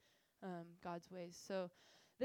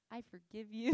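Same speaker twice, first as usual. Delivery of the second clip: laughing forgiveness; 7 dB louder than usual. Retold or imitated.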